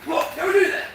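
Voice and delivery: high startled shriek. Real, not acted. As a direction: yelp it out, startled. Sudden shriek at someone.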